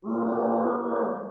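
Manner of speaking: storyteller's bear growl. A narrator's reading. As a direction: growl it out, throaty and long. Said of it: "growled the old bear".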